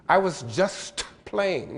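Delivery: "I was just playing" with the st dropped, jus playing; slow, say "just" without the t; no st sound. Shown as a demonstration. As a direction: lecturer demoing dialect. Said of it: "No S T sound".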